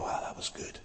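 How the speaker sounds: unvoiced whisper of an inner thought; hushed inner voice. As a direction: breathe it as a private thought. Imagined thought after a sermon.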